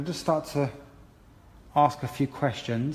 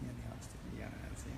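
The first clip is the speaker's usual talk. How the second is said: quick teasing murmur; 14 dB quieter, much faster than usual. Real, not acted. Still teasing the same student.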